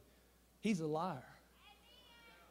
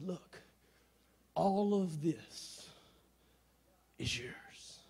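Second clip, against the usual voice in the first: hushed, very slow, solemn father; Mufasa; 2 semitones lower, 6 dB quieter, much slower than usual. Retold or imitated. Father showing his son the kingdom, hushed and solemn. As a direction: draw it out softly, sinking to a whisper.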